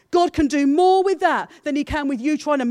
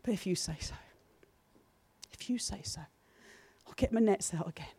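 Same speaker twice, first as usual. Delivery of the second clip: quiet faith; 3 semitones lower, 10 dB quieter than usual. Retold or imitated. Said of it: "deep voice of faith rising from within him".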